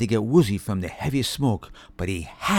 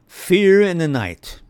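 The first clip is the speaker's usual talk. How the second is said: slow, announced title reading; chapter title; 6 semitones higher, 6 dB louder, slower than usual. A narrator's reading. Narrator announcing the new chapter title slowly. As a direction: read the title with weight. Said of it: "Chapter four".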